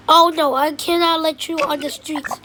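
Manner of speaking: usual speaking voice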